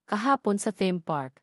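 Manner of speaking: normal talking voice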